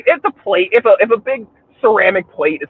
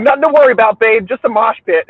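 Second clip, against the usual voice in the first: breezy reassurance; 7 semitones higher, 4 dB louder than usual. Retold or imitated. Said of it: "and I'm like".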